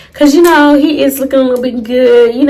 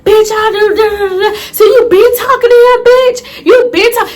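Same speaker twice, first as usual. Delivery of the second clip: high-pitched retold tirade; going off; 6 semitones higher than usual. Confronting cousin who talked to her ex, going off. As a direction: rant at her in rising outrage. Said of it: "I started going off. I'm like".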